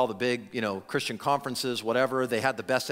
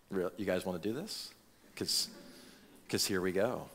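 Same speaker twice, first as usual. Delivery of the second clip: much lower and softer, amused challenge; smirking; 9 semitones lower, 11 dB quieter than usual. Retold or imitated. Jesus to the questioners, soft smirking dare. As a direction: relish the fight with a quiet smirk.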